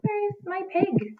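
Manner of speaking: usual speaking voice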